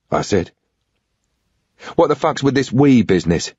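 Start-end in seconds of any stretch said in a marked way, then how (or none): 1.9-3.5 pissed off. Acted. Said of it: "South London gobby twang when pissed off".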